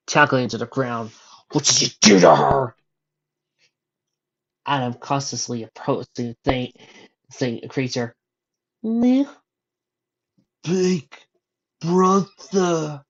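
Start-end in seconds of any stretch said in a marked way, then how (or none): 1.5-2.8 loud, high furious yell. Acted. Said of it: "Neil yelled and charged down". 8.8-9.3 high, loud shocked cry; shocked disbelief. Acted. Brother recognizes the creature. 10.6-13.0 lightly voiced dying creature; a hint of a rasping dying creature; raspy dying voice. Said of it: "in a raspy, dying voice".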